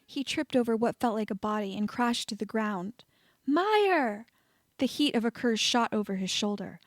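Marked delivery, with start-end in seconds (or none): 3.4-4.2 higher, calling out; desperate call. A narrator's reading. Harry calling for fallen Meyer, desperate. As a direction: call her name in panic.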